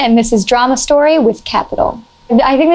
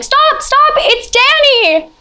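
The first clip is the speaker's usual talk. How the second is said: high screamed plea to stop; frantic high scream to save a friend; retold screaming; much slower than usual. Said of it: "everybody starts screaming".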